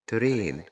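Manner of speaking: ترين with a long "ay" vowel. Shown as a demonstration. Teacher giving pupils an example word, long A in ترين. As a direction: say ترين as "trayn", the A held long. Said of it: "بتتنطق لونج A".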